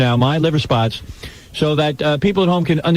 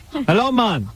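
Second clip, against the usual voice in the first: higher, mock Jamaican greeting; bad Jamaican accent; 7 semitones higher, slower than usual. Host plays the caller answering hello, bad Jamaican accent. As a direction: do a terrible Jamaican impersonation. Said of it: "Worst Jamaican accent I ever heard".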